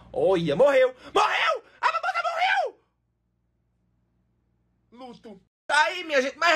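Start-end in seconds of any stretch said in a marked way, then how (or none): 0.1-0.9 lower-pitched, loud exclamation; startled announcement. Real, not acted. First notices the emoji died. 1.1-2.8 very high-pitched hammed-up wail; mock grief shriek. Mock grief at aloe's death. 4.9-5.4 very soft, hushed word; mock mourning. Mock mourning after the scream.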